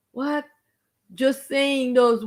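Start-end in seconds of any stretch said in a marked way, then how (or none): none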